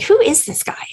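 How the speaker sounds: whispered in wonder; amazed whisper. Retold or imitated. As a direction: breathe it out, impressed. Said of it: "And I was like".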